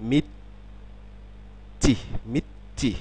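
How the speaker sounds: মিচ্চি with a held stop before chi; মিচ্চি, tense doubled ch. Shown as a demonstration. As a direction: pause on the closed mit, then release চি. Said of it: "ঠিক তেমনিভাবে".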